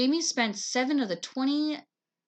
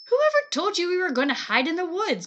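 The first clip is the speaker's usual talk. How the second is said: high, louder demand; 9 semitones higher, 6 dB louder than usual. Acted. Claudia demands an answer from Jamie, high and loud. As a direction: insist he tell who said it. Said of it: "Claudia demanded".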